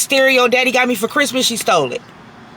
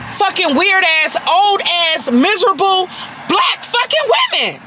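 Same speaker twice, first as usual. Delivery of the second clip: angry shout; 5 semitones higher than usual. Real, not acted. Vlogger yelling at older commenters, furious. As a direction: lash out at the commenters, voice climbing.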